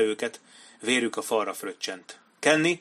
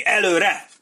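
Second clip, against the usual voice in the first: higher, louder rallying yell; commanding shout; 9 semitones higher, 8 dB louder than usual. A narrator's reading. Kenny urges his men on through the broken gate, shouting. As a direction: cry out the charge at full voice. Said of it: "kiáltotta Kenny".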